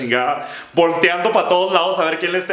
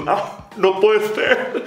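disbelief; 3 semitones higher, slower than usual. Pretend. Seeing a free seat.

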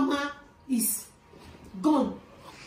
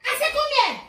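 high, loud command; insistent shout; 12 semitones higher, 7 dB louder than usual. Acted. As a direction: shout the order, demanding obedience. Mother repeats her order.

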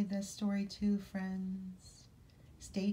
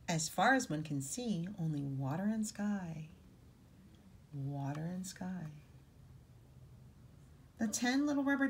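put-on reading to children; 2 semitones lower than usual. A narrator's reading. Reading picture book aloud.

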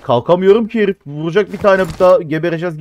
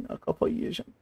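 whispered under the breath; whispered frustration; 16 dB quieter than usual. Real, not acted. Streamer repeating his frustration, whispered.

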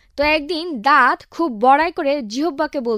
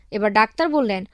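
normal talking voice

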